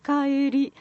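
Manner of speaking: 「帰り」 held high and level; high flat accent. Shown as a demonstration. Coaching an actor on Kyoto accent, 「帰り」 high and flat. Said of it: "上のゾーンで平らです".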